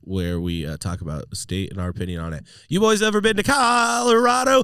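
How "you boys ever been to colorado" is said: high loud cowboy call. Pretend. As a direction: ham up a folksy cowboy host. Hosting bit, cowboy intro.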